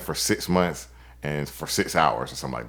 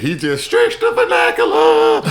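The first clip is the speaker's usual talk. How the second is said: surprised laughing shout; 8 dB louder than usual. Real, not acted. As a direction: shout it in amazed delight.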